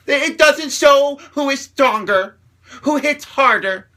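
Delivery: loud, high, drawn-out exasperation; real incredulous outburst at full volume; indignant rant. Real, not acted. Indignant climax of argumentative rant.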